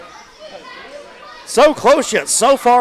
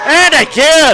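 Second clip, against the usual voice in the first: excited shout; 6 semitones higher, 6 dB louder, slower than usual. Real, not acted. Second big move lands.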